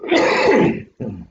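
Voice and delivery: sharp cough. Real, not acted. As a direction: cough hard mid-sentence. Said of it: "I can't stop coughing now".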